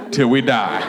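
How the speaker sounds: low, matter-of-fact mom; acted low-voiced mother; deadpan mother. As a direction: answer low and blunt. Plays mother answering her child.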